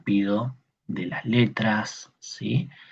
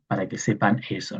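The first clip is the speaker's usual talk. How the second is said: ordinary delivery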